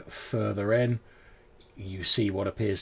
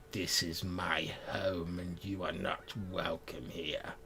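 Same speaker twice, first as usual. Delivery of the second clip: low, slow whisper; low haunting voice; 3 semitones lower, 7 dB quieter than usual. Acted. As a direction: whisper it like a hidden threat. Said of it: "low voice going".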